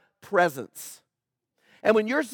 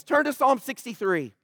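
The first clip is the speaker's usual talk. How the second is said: usual speaking voice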